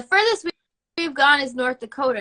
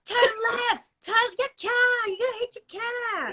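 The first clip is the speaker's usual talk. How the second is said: high-pitched Boston holler; Boston accent; 11 semitones higher than usual. Retold or imitated. Boston guy directing a bad parker, high Boston-accented calls. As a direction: call out directions with Boston dropped Rs. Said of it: "this guy from Boston".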